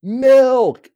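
sudden high, loud outburst; yelled out. Retold or imitated. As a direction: yell it out like a battle cry. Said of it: "just yells out".

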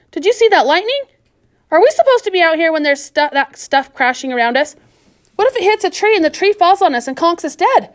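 put-on whiny girl griping about the work; girl Florida's voice, complaining.

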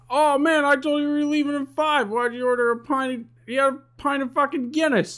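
high-pitched exasperated complaint; mock exasperation. Friend scolding a drinker for making them late, exasperated.

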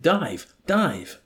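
high, loud urgent order; screamed command. A narrator's reading. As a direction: scream it to the pilot. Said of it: "to McWatt he screamed".

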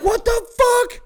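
retold high-pitched disbelief; shocked outburst. Storyteller relives his shock at the reckless driving, high outburst. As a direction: cry out in mock alarm, pitch leaping up.

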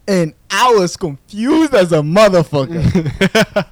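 loud disbelief. Real, not acted. Telling his podcast co-host about the baffling match, loud disbelief. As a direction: stretch out how baffled you were. Describing confusion, laughing.

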